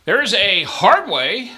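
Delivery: high, loud, slow stickman call of the hard way; sing-song casino call; drawn-out dealer call. Shown as a demonstration. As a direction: stretch each word out like a craps stickman. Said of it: "I gotta say it like that".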